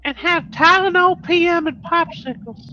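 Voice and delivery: put-on high-pitched feeble old man voice; Herbert from Family Guy. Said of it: "pretend to be, like, Herbert from Family Guy".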